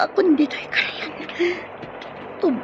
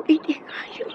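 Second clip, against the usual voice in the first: dying whisper; 3 dB quieter than usual. Acted. Dying woman whispers a prayer for her child. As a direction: fade out with last breath.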